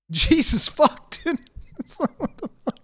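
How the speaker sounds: can barely get words out for laughing; real laughter, breathy and high. Real, not acted. Laughing at own joke.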